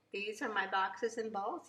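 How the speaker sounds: ordinary delivery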